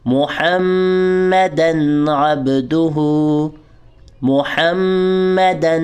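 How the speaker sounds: محمدا with a held nasal on the doubled م; ghunna on mim. Shown as a demonstration. Teacher modelling ghunna on mim. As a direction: hum the م in محمدا. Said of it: "غنہ کریں گے".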